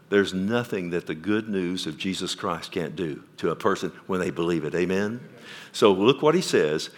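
usual speaking voice

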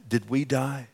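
ordinary delivery

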